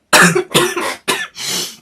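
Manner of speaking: a high, airy, rasping cough; sick streamer coughs again. Real, not acted. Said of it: "Don't catch my cold".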